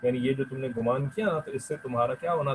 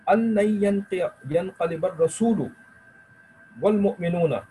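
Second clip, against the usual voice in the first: Quran recitation; 7 semitones higher, slower than usual. Acted. Recites Quranic verse in lesson.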